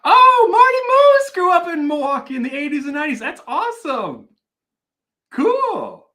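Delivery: high, loud burst of delight; whooping, high-pitched enthusiasm; real excitement. Real, not acted. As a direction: react thrilled to a viewer's hometown news. Delighted at news from chat.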